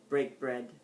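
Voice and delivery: ordinary delivery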